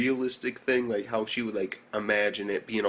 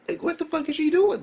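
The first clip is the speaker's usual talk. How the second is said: baffled; 4 dB louder than usual. Real, not acted. Baffled by the scene.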